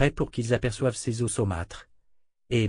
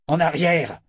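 raised, forceful order to get back; roared command; 6 semitones higher, 5 dB louder than usual. Acted. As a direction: bellow a warning at a threat. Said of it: "rugit Richard". (ordre rugi)

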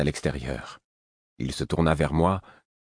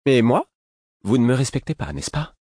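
high, pointed taunting questions; mocking; 8 semitones higher, 4 dB louder than usual. A narrator's reading. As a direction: challenge him with raised, teasing pitch. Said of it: "reprit Lestra, railleuse".

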